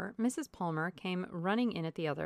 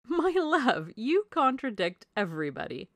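high, loud, playful scolding; gushy, unoffended protest; amused wifely chiding; 5 semitones higher, 7 dB louder than usual. A narrator's reading. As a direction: tease a husband brightly from a raised pitch. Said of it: "said his wife with her usual laugh".

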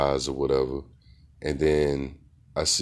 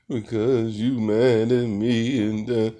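put-on tearful child; 8 semitones higher, 3 dB louder, slower than usual. Her eyes was filling up with water.

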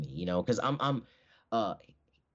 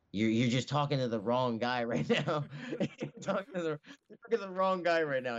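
higher, laughing through the words; amused, higher-pitched protest; laughing retort; 6 semitones higher than usual. Retold or imitated. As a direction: laugh it out while retelling a playful brush-off. Laughs telling coach he's mistaken.

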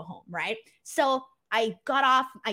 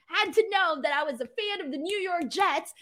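usual speaking voice

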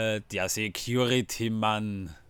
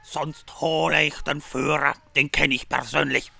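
rude security guard; 3 semitones higher, 6 dB louder than usual. Retold or imitated. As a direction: bark like a rude bouncer. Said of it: "Ungefähr so".

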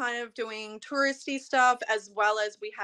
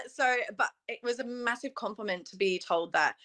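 ordinary delivery

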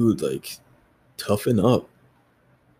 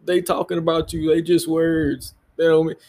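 parent voice; 7 semitones higher than usual. Retold or imitated. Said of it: "your parents looked at you like".

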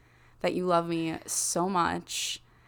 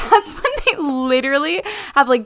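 laughing; 5 semitones higher, 11 dB louder than usual. Real, not acted. Laughing loud about execution.

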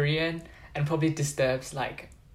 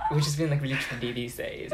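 ordinary talk

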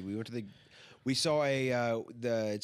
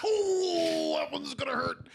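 high, whispered ooh of pain; wincing mock sympathy; 6 dB louder, slower than usual. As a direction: wince it out in a pinched whisper. Reacting to a hit on screen.

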